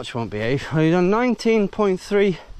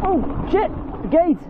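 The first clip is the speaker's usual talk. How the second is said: sudden high startled cry; startled shout; 14 semitones higher than usual. Real, not acted. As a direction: caught off guard by a gate. Suddenly sees the gate ahead.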